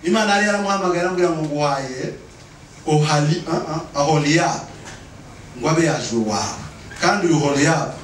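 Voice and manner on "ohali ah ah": ordinary talk, nothing marked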